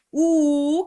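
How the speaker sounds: U held long, loud and high; stressed lone vowel. Shown as a demonstration. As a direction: punch and stretch the U. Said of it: "ficou formando sílaba sozinho e é tônico".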